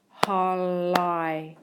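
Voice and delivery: "holi" stretched, ending in a long eye sound; teacher slowly blending "holi". Shown as a demonstration. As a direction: draw out "holi" as holl-eye. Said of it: "sounds that I can hear".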